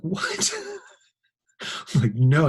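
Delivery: squeaked very high with a laugh; can't believe it is happening; shocked disbelief. Retold or imitated. Teacher's shocked reaction.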